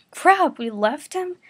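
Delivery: high, loud, slow alarmed cry; gasping alarm. Acted. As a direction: gasp it out in alarm. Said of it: "Yusuke gasped".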